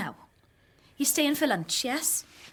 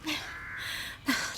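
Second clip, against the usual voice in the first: a breathy, moved sigh; soft sigh; 7 dB quieter than usual. Acted. Christine moved by the crib, soft sigh. Emotional moment over the gift.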